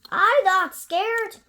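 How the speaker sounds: high, loud bold little voice; brave child. Acted. Gruffalo's child boasting, high and loud. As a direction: insist you're not scared. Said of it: "said the Gruffalo's child".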